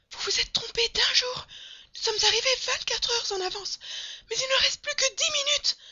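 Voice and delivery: very high, loud, strained shouting; frantic cry. A narrator's reading. Servant warning master time is nearly gone, frantic shriek. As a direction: shout desperately, far above normal pitch. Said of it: "s'écria Passepartout".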